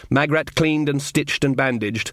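ordinary delivery, nothing marked

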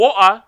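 comfortable English "Water", glottal stop; casual glottal water. Shown as a demonstration. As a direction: swallow the T in "Water". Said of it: "is, like, when you comfortable".

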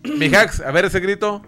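loud teasing call-out. Real, not acted. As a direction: shout it out teasingly. Calling for a shout.

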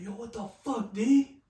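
high, shocked angry father; a dad yelling at his son about his wrecked truck. Retold or imitated. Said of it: "and so he's like".

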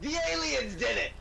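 high, rushed documentary host; TV presenter. Acted. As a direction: announce it like a cable TV host. Parody of a TV presenter.

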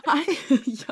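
yeah through a laugh. Real, not acted. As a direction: burst out laughing. Bursts out laughing.

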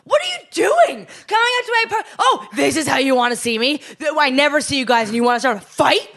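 high, loud angry outburst; lashing out. Retold or imitated. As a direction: snap back at them hard. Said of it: "lashing out at my parents".

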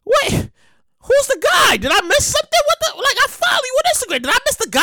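high, confused, half-laughing disbelief; baffled fan. Retold or imitated. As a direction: ask like you missed the news. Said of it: "And I'm like".

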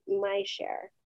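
ordinary delivery

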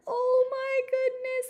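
high, loud "oh my goodness" in mock alarm; retold panicked gasp. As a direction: replay the shock of the dog jumping up. Retold panic at dog in interview.

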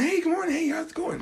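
high, soft imitation of a chipper greeting; mock a coworker's polite, high-pitched hello; coworker's morning voice. Coworker greeting everyone each morning, high soft mimicry. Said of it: "Like, this is his voice".

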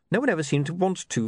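plain reading voice

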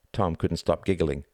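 usual speaking voice

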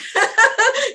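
high bright burst of laughter; laughing demonstration; demo laugh. Shown as a demonstration. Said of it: "Here's a great demonstration".

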